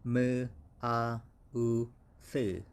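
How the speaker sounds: "m, a, u, th" eja per bunyi dengan jelas; spelled-out sounds. Shown as a demonstration. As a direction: sound out each piece, slowly. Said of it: "the pronunciation for this one is".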